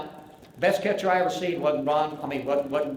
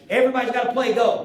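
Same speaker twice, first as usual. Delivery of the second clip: louder, higher insistent command; firm coach order; 6 semitones higher, 5 dB louder than usual. Retold or imitated. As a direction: bark it like a coach who won't take no.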